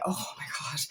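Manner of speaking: breathy whisper under the breath; whispered oh my God. Real, not acted. Telling viewers about her first workouts, whispered aside. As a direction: hush it, half to yourself. Recalling her clumsy start.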